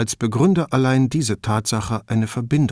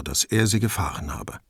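plain reading voice

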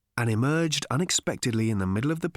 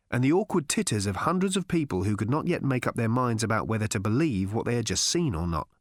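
ordinary delivery

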